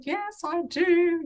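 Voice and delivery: imitating a tearful young girl, high and slow; upset young self. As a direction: replay her small, shaky yes through tears. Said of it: "I was all upset".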